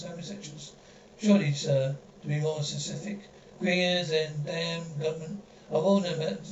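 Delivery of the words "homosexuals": homosexuals breathed out in an unvoiced whisper. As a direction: drop to a scandalised whisper on homosexuals. Repeats word, second whispered.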